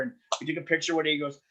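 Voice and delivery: ordinary talk, nothing marked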